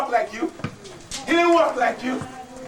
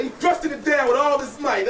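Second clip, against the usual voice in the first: ordinary delivery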